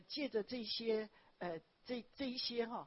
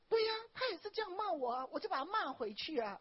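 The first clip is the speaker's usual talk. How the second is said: fast, high, louder retort; indignant sister; 8 semitones higher, 6 dB louder than usual. Retold or imitated. Church sister tells how she scolded coach back, fast and fired-up. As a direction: rattle it off with a fiery edge. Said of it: "他就说".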